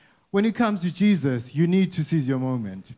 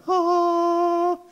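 high sustained gasp of awe; awed crowd sigh; 14 semitones higher than usual. Acted. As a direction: let out a long wondering ah.

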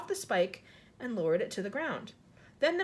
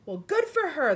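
loud, higher exclamation; hearty storybook cry; 4 semitones higher, 6 dB louder than usual. A narrator's reading. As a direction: call out approval like an impressed cop. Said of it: "the cops cri-, cops cried out".

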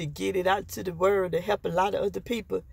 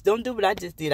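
ordinary talk

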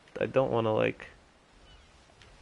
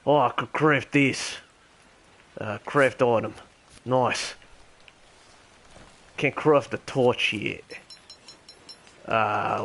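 terrible mock Australian accent; Ringo the Dingo. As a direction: chat along in a badly faked Australian accent. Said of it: "my terrible Australian accent".

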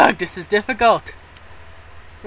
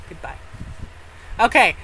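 normal talking voice

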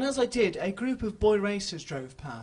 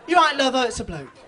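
louder run-on line, no pauses; laughing, louder run-together quote; one continual sentence; 3 semitones higher, 10 dB louder than usual. Retold or imitated. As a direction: slur it all into one breath, louder. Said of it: "In one continual sentence".

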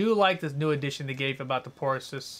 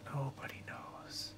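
whispering; 11 dB quieter, much slower than usual. Real, not acted. Hushed stealth reply.

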